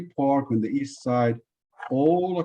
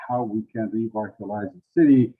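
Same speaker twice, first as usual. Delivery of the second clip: normal talking voice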